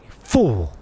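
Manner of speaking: low, loud mock scolding; Ghost. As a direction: burst out in a deep put-on voice. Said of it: "doing a very good impression of Ghost".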